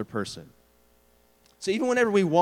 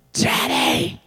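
loud unvoiced child's stage whisper; whisper-yell; 5 dB louder than usual. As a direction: whisper it as loud as you can. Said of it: "they whisper yell".

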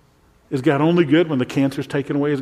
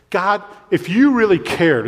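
accusing plea; 6 semitones higher, 4 dB louder than usual. Retold or imitated. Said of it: "is what they're saying".

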